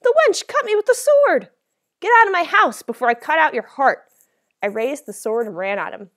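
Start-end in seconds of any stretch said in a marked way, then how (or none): 0.0-1.5 very high, loud incredulous yell; wounded robber's high outcry; shocked disbelief. A narrator's reading. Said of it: "he said in disbelief". 2.0-4.1 loud, high shouted threat; enraged full-voiced screaming order; furious threat. A narrator's reading. Girl threatens robber with sword.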